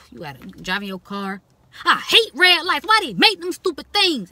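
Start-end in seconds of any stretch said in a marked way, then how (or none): 1.8-4.3 high, loud furious road rage; loud, pitched-up angry driver outburst; angry rant. Retold or imitated. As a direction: yell at the red lights like you're fed up. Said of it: "And you just mad".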